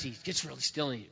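ordinary delivery

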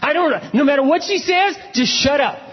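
very high, strained urging; emphatic exhortation. Retold or imitated. Urging a pastor friend to hold his tongue, emphatic. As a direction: hammer the advice home in a high voice.

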